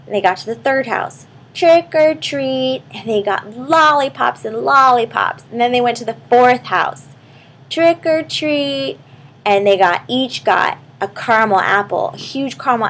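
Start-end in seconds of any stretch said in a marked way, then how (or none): 1.5-2.8 high, slow sing-song door call; trick-or-treat call. Acted. Kids at the door, high slow sing-song call. 7.7-9.0 high, slow sing-song door call; trick-or-treat call. Acted. Kids at the door, high slow sing-song call.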